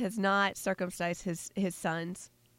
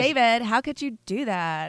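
put-on whiny Valley Girl voice, a little higher and louder; 4 semitones higher, 6 dB louder than usual. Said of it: "a Valley Girl voice for her".

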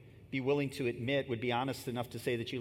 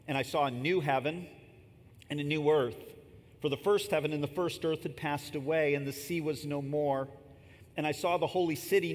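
scripture reading; 3 semitones higher than usual. Acted. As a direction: proclaim the text to the congregation. Reads Revelation aloud.

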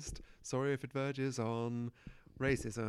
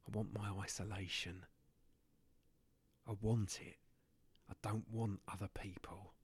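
quiet intensity; 5 semitones lower, 6 dB quieter than usual. Acted.

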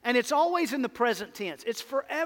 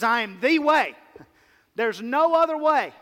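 emphatic proclamation; 4 semitones higher, 6 dB louder than usual. Acted. Preacher quoting Jesus emphatically.

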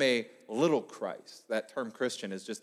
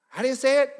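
put-on high, loud, taunting kid; cheeky student higher and louder; mocking student; 11 semitones higher, 7 dB louder, faster than usual. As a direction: needle the sub like a smart-aleck kid.

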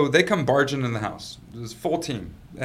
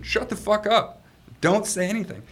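higher, punchy warning; emphatic advice; 8 semitones higher than usual. Real, not acted. Advising anybody out there in this situation, emphatic. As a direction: hammer home each word of advice.

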